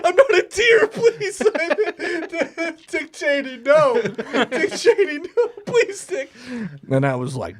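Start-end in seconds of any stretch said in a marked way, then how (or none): 0.0-6.3 squealing falsetto plea broken by laughter; begging deer voice. Pretend. Shot deer pleading with Dick Cheney, squealing mock begging. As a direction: beg for mercy in a silly, very high voice.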